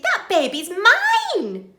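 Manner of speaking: high, loud, slow joyful claim; overjoyed claim. Acted. Betty claims her lost baby, overjoyed. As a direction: declare it with joy.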